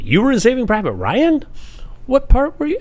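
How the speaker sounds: high, loud mock surprise; surprised question. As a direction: imitate a stranger's amazed question. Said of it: "somebody just asked me yesterday".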